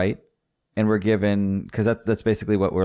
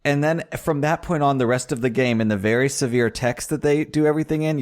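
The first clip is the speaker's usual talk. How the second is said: ordinary delivery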